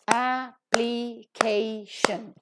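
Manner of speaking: "application" very slowly in four pieces, a-pli-kay-shun; syllable by syllable. Shown as a demonstration. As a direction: break "application" into separate drawn-out syllables. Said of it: "Four syllables are there".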